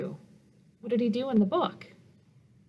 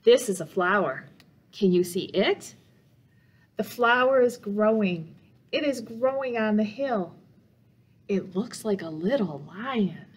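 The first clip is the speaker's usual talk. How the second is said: put-on reading to children. A narrator's reading. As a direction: read it gently to little listeners, asking them questions. Reads picture book to children.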